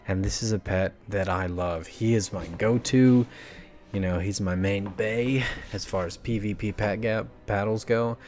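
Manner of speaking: ordinary talk, nothing marked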